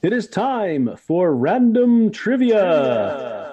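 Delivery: high, slow announcer flourish; segment announcement. Pretend. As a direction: stretch out the big segment title. Co-host answers with segment announcement.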